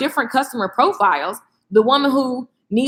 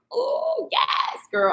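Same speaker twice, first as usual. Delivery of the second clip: mock excited cry; 5 dB quieter, much slower than usual.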